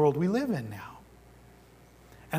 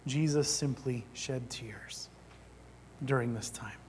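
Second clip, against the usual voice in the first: hushed, quiet; 5 semitones lower, 9 dB quieter than usual. Retold or imitated. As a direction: drop almost to a whisper to show quiet tears. Repeats Jesus' quiet weeping, against wailing.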